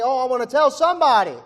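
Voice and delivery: loud, high, eager cry; excited outburst. Acted. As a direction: burst out, eager to share good news. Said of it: "You remember how excited you were".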